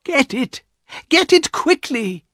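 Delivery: high-pitched, insistent command; urgent cry. A narrator's reading. Wife orders husband to fetch paw, urgent cry. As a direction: press harder on the repeat, pitch soaring.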